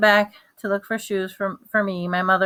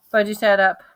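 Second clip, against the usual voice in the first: usual speaking voice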